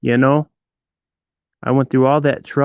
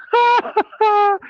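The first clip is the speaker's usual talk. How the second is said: a shrieking burst of laughter, very high; high laughing fit. Real, not acted. Streamer mocking his rival, a shrieking burst of laughter.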